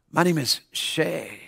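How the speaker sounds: slow, low, very soft mumble; imitating odd kid. Odd mohawked kid introduces himself to the preacher, slow imitation. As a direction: drag it out low and barely audible. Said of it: "That's how he talked".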